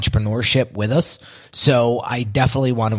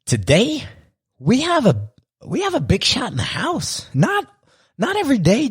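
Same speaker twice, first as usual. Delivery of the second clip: rising, much higher, excited build-up; hyped host intro; 11 semitones higher than usual. Real, not acted. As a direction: build excitement like announcing a star. Host hyping guest entrance.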